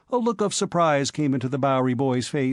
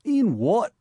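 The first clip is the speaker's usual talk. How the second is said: surprised; 8 semitones higher than usual. A narrator's reading. Cabman asks back.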